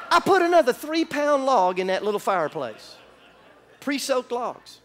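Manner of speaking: usual speaking voice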